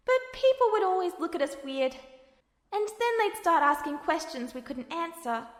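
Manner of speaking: put-on a kind, patient kid brother explaining; gentle younger brother Al. Al speaks to Ed.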